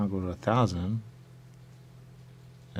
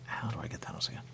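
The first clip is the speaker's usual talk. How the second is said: unvoiced, much softer whisper; 3 semitones higher, 9 dB quieter, faster than usual. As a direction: ask it under your breath. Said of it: "he has to be like".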